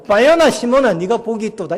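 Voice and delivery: bright, loud praise, high on the name; joyful praise. Retold or imitated. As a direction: call out Simon with delight. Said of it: "주님이 막 기뻐시가지고".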